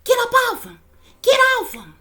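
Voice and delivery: high mock scream of get up off him; shrill-pitched plea to break up the fight; woman shrieking at a brawl; imitating Jaguar screaming. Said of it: "she's saying".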